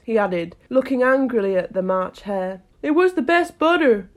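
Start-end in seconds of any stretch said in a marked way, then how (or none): 2.8-4.1 meek. A narrator's reading. March Hare answering the cross Hatter, meek. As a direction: offer a meek defense in a high voice. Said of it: "meekly replied".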